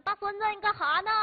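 put-on high, loud old granny calling out; grandma voice. Grandma phoning her grandson, high and loud. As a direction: play the doting grandma asking what he's up to.

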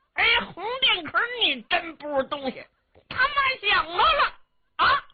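put-on a big naive boy taking offence; annoyed simple-minded giant. Simple young hero scolding the squire, high and cross.

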